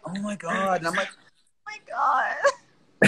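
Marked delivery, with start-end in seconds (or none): 1.1-3.1 tiny, high, whispery whine; whiny. Retold or imitated. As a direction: exaggerate it into a faint high whimper. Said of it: "but I hear it whiny".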